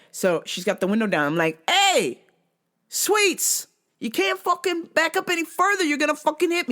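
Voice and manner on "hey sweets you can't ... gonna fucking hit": high loud warning yell. Retold or imitated. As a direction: call out to the driver, higher. Yelling at reversing driver.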